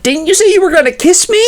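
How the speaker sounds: high, fast whine; quick high complaint about the promised kiss; whiny Todd. Acted. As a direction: plead like a sulky boyfriend.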